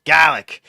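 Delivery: garlic said kalik, r dropped mid-word; Boston garlic. Shown as a demonstration. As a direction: swallow the r in the middle of garlic, loud. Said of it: "weird version of words is right in the center".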